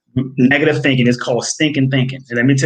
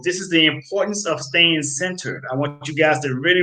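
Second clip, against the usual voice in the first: ordinary talk, nothing marked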